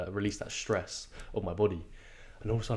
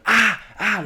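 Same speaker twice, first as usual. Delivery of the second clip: high, loud sprinting yells; retold yell; 11 semitones higher, 10 dB louder than usual. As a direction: shout yourself through the last stretch. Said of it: "And I do it again".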